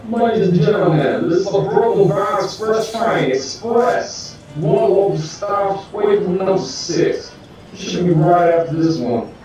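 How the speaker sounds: mumbled subway intercom. Acted. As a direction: blare it like a train announcement. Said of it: "the conductor's word".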